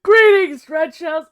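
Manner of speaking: high, loud mock-formal hail; grand greeting. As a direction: hail them like a herald.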